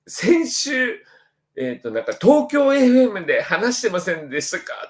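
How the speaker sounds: high, eager questioning junior; imitating a junior. Junior asks the senior if he was on Tokyo FM, high and eager. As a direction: ask like a starstruck younger student. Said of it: "大学の後輩とかと一緒に飲んだったりしてて".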